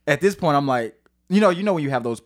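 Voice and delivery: normal talking voice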